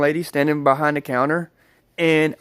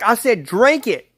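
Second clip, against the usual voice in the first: retold insistent order, pitched up; upset friend; 6 semitones higher, 3 dB louder than usual. Friend repeating her order to drink, upset. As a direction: imitate an upset friend's demand. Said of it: "she's getting really upset".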